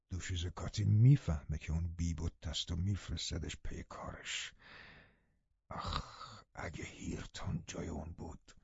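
low, hushed bitter muttering; a father muttering bitterly about his weak son, low and hushed; muttered aside. A narrator's reading. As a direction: grumble it under your breath to Nelly. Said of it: "زیر لب به من گفت".

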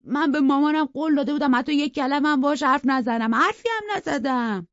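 high, loud and petulant, slowing at the end; whiny pampered boy. Acted. Edgar whines to Cathy that he kept his promise. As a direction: sulk like a spoilt child.

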